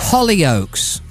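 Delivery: usual speaking voice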